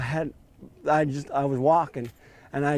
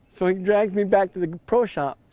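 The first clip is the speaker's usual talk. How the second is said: ordinary delivery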